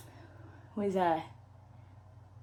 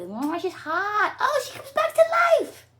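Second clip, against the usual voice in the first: mock wail; 11 semitones higher, 6 dB louder than usual. Mocking the ending.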